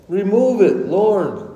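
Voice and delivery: high, urgent prayerful appeal; acted plea to God. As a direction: plead upward in distress. Acting out a desperate prayer.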